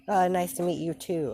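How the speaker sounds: high, loud cheery reply; put-on cheer. Deku answering the little girl, high forced cheer.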